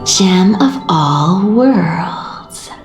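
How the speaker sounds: slow, savouring each word; lingering, stretched-out musing. Acted. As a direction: draw the words out slowly, lost in thought. Musing on the fabled gem.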